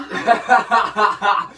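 startled shriek breaking into laughter; scream into giggles. Real, not acted. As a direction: yelp then burst out giggling. Son scared, then laughing.

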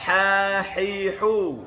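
Ha, hi, hu with airy friction from mid-throat. Shown as a demonstration. Teacher demonstrates spicy-mouth h, airy Ha, hi, hu. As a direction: let air out first on each drawn-out Ha, hi, hu. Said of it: "makhraj tengah-tengah kerongkong".

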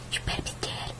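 voiceless menacing whisper; creepy whisper. Acted. To the frightened child, a creepy whisper.

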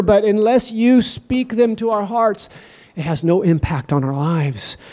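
hushed low opening prayer, rising to fervent pleading. Acted. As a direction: pray it earnestly to God.

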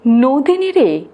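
ordinary delivery

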